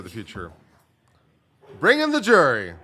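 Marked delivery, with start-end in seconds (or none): 1.8-2.7 loud, high call of a mock judge. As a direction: boom it out like a judge calling the court. Mimicking the judge's court call.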